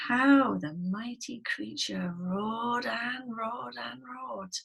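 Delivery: slow, drawn-out storytelling; dramatic story narration. A narrator's reading. Teacher telling how trapped lion roared, slow dramatic narration. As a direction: stretch the words out like a long roar.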